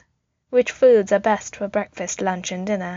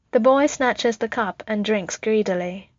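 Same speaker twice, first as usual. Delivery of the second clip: ordinary delivery, nothing marked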